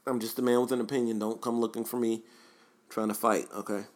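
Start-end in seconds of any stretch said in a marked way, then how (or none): none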